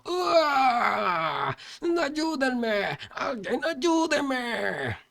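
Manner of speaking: high, wailing cries for help; desperate high-pitched victim's scream. A narrator's reading. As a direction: scream out, stretching the last plea.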